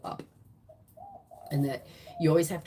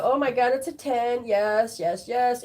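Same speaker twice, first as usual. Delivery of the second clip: mock excited; 4 semitones higher, 6 dB louder than usual. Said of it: "you're gonna sit there and go".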